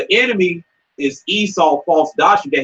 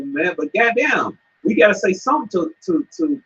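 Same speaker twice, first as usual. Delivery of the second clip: ordinary delivery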